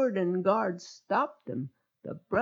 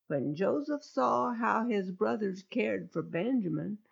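plain reading voice

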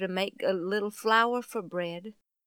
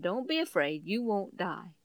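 calm a frightened man; reassuring; 4 semitones lower, 8 dB quieter than usual. Acted. The Lord comforting frightened Gideon, soft and low. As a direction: lower and softer, gentle comfort.

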